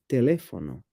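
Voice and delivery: teléfono stressed on LÉ; accented-syllable stress. Shown as a demonstration. Giving partner an example, teléfono stressed on LÉ. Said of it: "accent mark is used to indicate where the stress falls".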